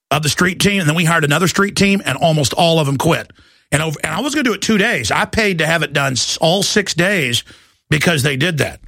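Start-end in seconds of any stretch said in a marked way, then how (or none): none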